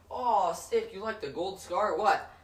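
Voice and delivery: imitated hustler hyping up a kid; street hawker. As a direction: play the pushy seller talking gamer slang. Same street seller voiced.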